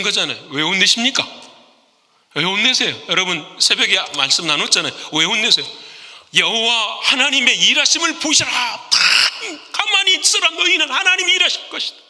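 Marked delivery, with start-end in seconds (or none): 6.3-12.0 high, ringing proclamation, quickening; Moses proclaiming. Retold or imitated. Moses proclaiming to the people before the sea, ringing high. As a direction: cry it out to the frightened crowd.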